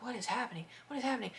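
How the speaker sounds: soft hushed disbelief; bewildered whisper. Retold or imitated. Lying in bed hearing the fight, whispered bewilderment. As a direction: breathe it out in confusion.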